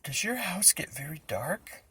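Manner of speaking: high, hushed worried question; nervous whisper. A narrator's reading. Boy whispering nervously to Reggie about the dark. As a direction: ask while trying to hide fear.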